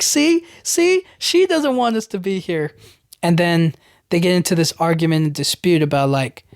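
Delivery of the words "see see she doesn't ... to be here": mock high exasperation. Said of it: "He's like".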